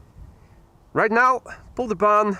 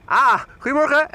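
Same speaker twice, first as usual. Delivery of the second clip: higher, louder delighted "Ah, goedemorgen"; surprised greeting; 6 semitones higher, 5 dB louder than usual. Real, not acted. Streamer startled to see a viewer nearby, delighted greeting. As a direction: burst out in happy recognition. Suddenly noticing a viewer beside him.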